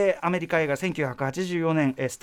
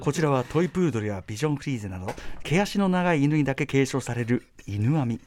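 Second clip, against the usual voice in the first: documentary narration; 4 semitones lower than usual. Shown as a demonstration. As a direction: read it like the narrator of a train travel show. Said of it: "世界の車窓からみたいな".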